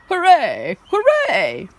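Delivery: high, loud hooray; royal cheer. Acted. King and queen cheer the winner, high loud. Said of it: "The king and queen cheered too".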